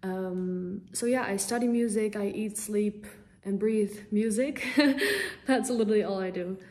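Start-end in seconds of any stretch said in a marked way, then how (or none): 4.7-5.4 bright little giggle; quick high giggle after a joke about herself; short laugh. Real, not acted. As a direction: laugh it off lightly. Singer laughs at her own remark.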